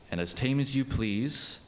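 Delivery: ordinary delivery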